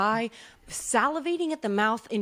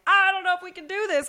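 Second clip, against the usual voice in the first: mock whiny owners; 10 semitones higher, 5 dB louder than usual. Owners whine to the vet.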